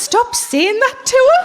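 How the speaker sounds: very high, slow, exasperated plea; wife telling fella off, high and slow. Retold or imitated. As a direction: stretch it out, fed up. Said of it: "I said".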